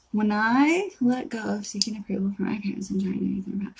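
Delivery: hushed, voiceless read-through; whispered reading. Real, not acted. Host reading a message about parents' approval, whispered. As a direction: read it under your breath. Reading a comment under her breath.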